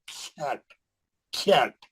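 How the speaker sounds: ख्याट with a thin, flat "a" vowel; thin, spread-mouthed. Shown as a demonstration. Teacher shows the mistaken thin reading to students, spread-mouthed. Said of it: "पतला पढ़ रहे हैं".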